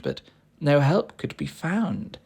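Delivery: plain reading voice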